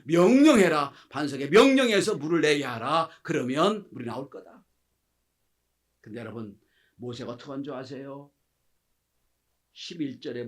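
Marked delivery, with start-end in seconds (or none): 0.1-4.6 loud, firm divine command; loud, firm order to Moses, with 명령해서 stressed; commanding God. Acted. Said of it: "그래서 하나님이 말씀하십니다".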